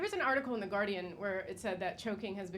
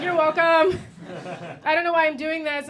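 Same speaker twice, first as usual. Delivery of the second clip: hammed-up shout; 7 semitones higher, 11 dB louder than usual. Guy's line voiced.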